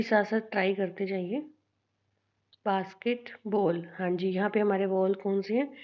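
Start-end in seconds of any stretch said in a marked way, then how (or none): 2.7-3.9 basketball slowly, with pauses between its parts; split into syllables. Shown as a demonstration. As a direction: break basketball up, a gap before बॉल. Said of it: "syllables कैसे अलग करेंगे".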